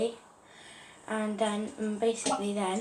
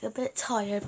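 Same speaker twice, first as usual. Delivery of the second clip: normal talking voice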